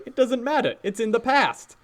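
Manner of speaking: high-pitched imitation of a wise cartoon character; Rafiki. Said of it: "Rafiki voice".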